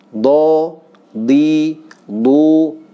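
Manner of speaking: teacher drilling dhad; letter drill. Shown as a demonstration. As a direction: chant dhad syllables.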